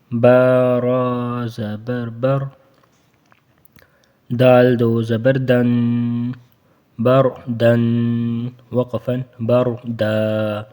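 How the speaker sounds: slow syllable-by-syllable spelling, بر دا with a heavy ر; teacher's slow drill. Shown as a demonstration. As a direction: sound out each letter slowly. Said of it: "اس را کو موٹا پڑھنا ہے".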